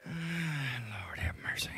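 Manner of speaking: muttered lament. Real, not acted. Groaning at the stink.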